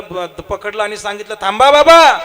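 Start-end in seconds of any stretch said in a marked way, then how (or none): 1.4-2.2 calling out. Retold or imitated. Boys stop the varkari.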